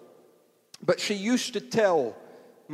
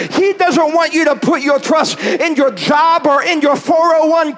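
loud, high, shouted preaching; preaching at full force; 8 semitones higher, 10 dB louder than usual. Acted. Preacher rousing the congregation to trust God, shouting.

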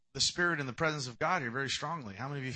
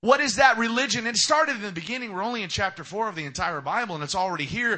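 loud, high preaching build; full-force preaching; 6 semitones higher, 10 dB louder than usual. Acted. Preacher driving home a point to the congregation, loud and high.

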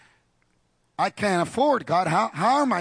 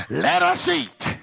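triumphant; 10 semitones higher, slower than usual. Retold or imitated. Missionary's triumphant line.

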